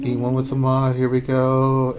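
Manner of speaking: normal talking voice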